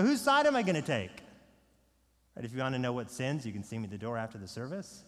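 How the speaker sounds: ordinary talk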